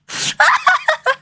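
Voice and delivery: very high giggle; squeaky laugh. Real, not acted. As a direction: burst out giggling. Laughs at own parody.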